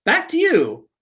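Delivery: high, quick TV sign-off; hurried reporter sign-off. Retold or imitated. Reporter handing back to the studio, hurried sign-off. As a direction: cut it short like a flustered reporter.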